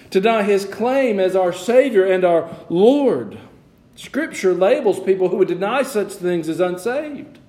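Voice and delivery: high, ringing pulpit delivery; full-force preaching. Acted. Pastor extolling the price Christ paid, fervent preaching. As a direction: drive each phrase home with fervor.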